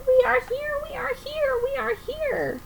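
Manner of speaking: high, frightened calling out; fearful cry. A narrator's reading. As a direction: cry out in fear from below. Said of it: "They cried out in fear".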